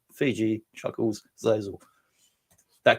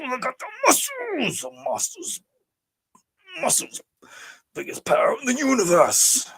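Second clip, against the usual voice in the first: drawn-out words swooping from usual pitch to high wails; hammed-up put-on voice; 3 semitones higher than usual. Host does a voice for a viewer's list, hammed-up. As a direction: strain the voice into a wild, swooping character. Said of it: "we gotta do a voice for the Chris Mewa".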